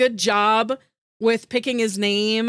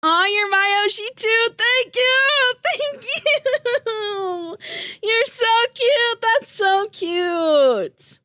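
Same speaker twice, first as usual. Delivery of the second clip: much higher, teary gushing; high, slow, emotional thanks; gushing squeal; 10 semitones higher, much slower than usual. Real, not acted. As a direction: melt into a touched squeal. Touched by chat compliment.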